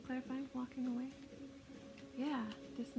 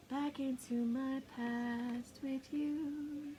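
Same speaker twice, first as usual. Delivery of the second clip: slow; much slower than usual. Real, not acted. Talking to card slowly.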